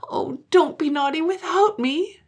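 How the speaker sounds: high-pitched wailing plea; acted childish crying; tearful child. A narrator's reading. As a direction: beg like a little girl in tears. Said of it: "burst into tears".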